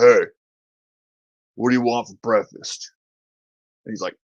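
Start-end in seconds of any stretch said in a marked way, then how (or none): 0.0-2.9 lightly voiced big Australian dude; casually ask, imitating an Australian father; a hint of a big Aussie dad. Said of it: "big Australian dude walks in and goes".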